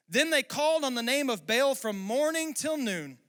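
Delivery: loud, high, driving preacher voice; full-force preaching. Acted. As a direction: preach it to the rafters.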